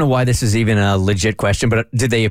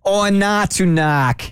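put-on Boston accent; 10 semitones higher, slower than usual. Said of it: "It's Sandra Bullock".

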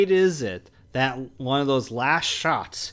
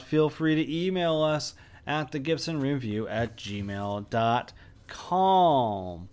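ordinary talk, nothing marked

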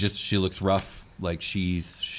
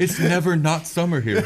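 high, laughing, louder; 10 semitones higher, 6 dB louder than usual. Real, not acted. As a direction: laugh while you say it. Table laughing at desert joke.

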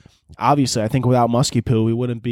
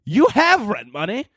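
pitched-up exasperated lecture to a friend; exasperated scolding; 9 semitones higher than usual. Pretend. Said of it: "I'm gonna be like".

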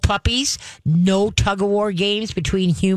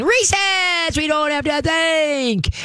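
high squealing recess cheer; excited kid voice; 10 semitones higher, slower than usual. Pretend. Trainer mocking the puppy's overexcited reaction, high and squealy. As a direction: squeal it like a kid let out to play. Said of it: "That's like saying".

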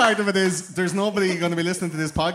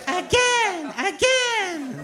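put-on baby voice; much slower than usual. Teletubby voice.

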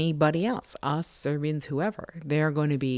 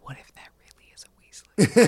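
conspiratorial whisper; 3 semitones higher, 7 dB louder, faster than usual. Acted. Conspiratorial fan theory aside.

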